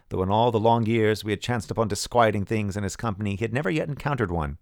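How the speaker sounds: ordinary delivery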